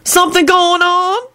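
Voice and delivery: very high prying question; suspicious. A narrator's reading. Lucretia questioning the pair, suspicious. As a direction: probe with high-pitched suspicion. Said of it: "she said suspiciously".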